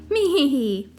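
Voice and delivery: high squeaky little creature giggle. Acted. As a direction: giggle like a tiny fluffy pet. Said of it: "it repeated".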